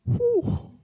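a sharp high breath out, fighting back tears; emotional exhale. Real, not acted. Pastor regaining composure mid-testimony, a shaky emotional exhale. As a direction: blow out hard to steady yourself.

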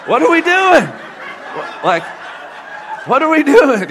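high, loud, laughing disbelief; flustered laughing yelp; laughing panic. Retold or imitated. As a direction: shriek it through laughter. Panicked reply while laughing.

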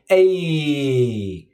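long drawn-out catchphrase, sliding down; the Fonz. Retold or imitated. Childhood TV hero's unforgettable catchphrase, imitated with a long glide. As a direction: stretch it out like a cool sitcom greaser. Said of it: "the Fonz on Happy Days emote his unforgettable".

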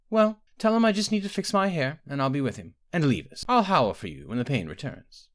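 brisk, commanding royal; queen voice. Acted. Queen giving orders.

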